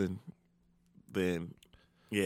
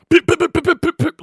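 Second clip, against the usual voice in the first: loud, high, rapid popping; sharp staccato imitation of hail hits; imitated hail pelting; 15 dB louder than usual. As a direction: fire off hail noises in quick bursts. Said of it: "All I could hear is".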